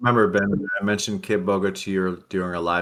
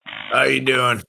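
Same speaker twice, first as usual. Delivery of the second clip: higher-pitched farmer twang greeting; country twang; 5 semitones higher, 3 dB louder, slower than usual. Acted. Co-host rehearses greeting for the call, high country twang. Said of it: "I can do a little twang".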